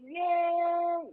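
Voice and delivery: high, drawn-out wail on one note; dream cry. Retold or imitated. Caller re-enacting her dream for the host, sustained high cry. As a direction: hold the word long and high.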